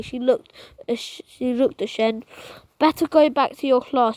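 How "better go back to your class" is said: ordinary delivery, nothing marked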